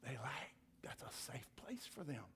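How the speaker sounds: a conspiratorial whisper to the congregation. Real, not acted. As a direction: lean in and whisper it like a secret. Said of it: "preacher drops to secret aside".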